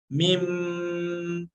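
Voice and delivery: मीम held very long, lips slightly open, nasal; drawn-out mim. Shown as a demonstration. Teacher shows ikhfa of meem saakin, very slow held hum. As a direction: stretch मीम slowly with the lips barely parted. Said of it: "होठों को थोड़ा खुला रखा जाए".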